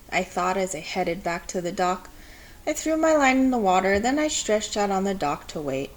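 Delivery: plain reading voice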